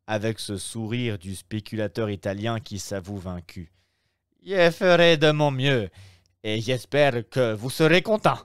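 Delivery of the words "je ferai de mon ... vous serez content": higher, louder obsequious innkeeper voice; innkeeper, fussy. Acted. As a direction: play the eager Roman host, pitched up. (aubergiste empressé, voix plus aiguë et plus forte)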